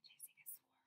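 soft secret whisper; storyteller whispering the punchline to kids; whispered reveal. A narrator's reading. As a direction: share it like a secret.

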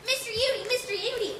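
loud, high call across the store. Acted. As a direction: call out to him from the doorway. Girl calling to shopkeeper.

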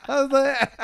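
squealing high laughter; high cracking-up laugh. Real, not acted. Cracking up at own story.

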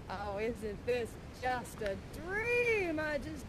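slow, soft marvelling; dreamy wonder. Acted. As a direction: breathe it out softly in slow delight. Marvels dreamily after arriving.

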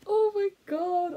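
breathless and overcome; overwhelmed. Real, not acted. Overwhelmed by tattoo.

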